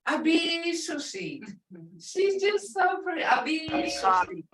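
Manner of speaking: put-on a touch of Abisushi. cooed over a baby; imitating mom adoring a baby; mom cooing. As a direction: lightly voice mom doting on a chubby baby. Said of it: "And she would say".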